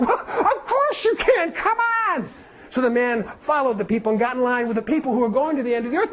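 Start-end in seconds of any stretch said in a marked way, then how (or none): 0.1-2.3 put-on high, slow, cheerful girl's voice; little girl's welcome. Little girl inviting the young man along, high and cheerful. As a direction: welcome him in like a happy child.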